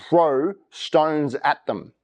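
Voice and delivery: spelled out very slowly; slow explanation. Shown as a demonstration. Pastor explains what stoning means, very slowly. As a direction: lay it out slowly so it sinks in.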